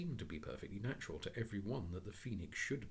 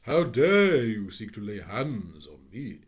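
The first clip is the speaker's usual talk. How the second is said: much louder, slow indignation; indignant phoenix; 16 dB louder, slower than usual. Acted. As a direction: rebuke the porter. Phoenix rebukes porter.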